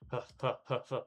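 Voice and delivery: ragged breaths from running hard; panting. Acted. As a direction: pant hard between strides.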